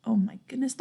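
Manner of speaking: normal talking voice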